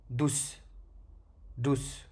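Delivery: DOUCE ending on a bare S; vowelless final letter. Shown as a demonstration. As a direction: land on the S of DOUCE. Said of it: "On tombe sur la lettre comme ceci".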